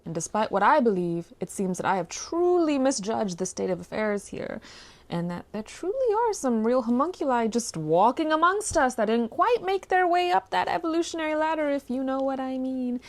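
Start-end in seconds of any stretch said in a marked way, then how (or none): none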